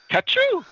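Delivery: "Kachu" burst out high and loud like a sneeze; sneeze-like name. Shown as a demonstration. Podcaster playing up the nickname after "bless you", mock sneeze on "Kachu". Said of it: "Bless you".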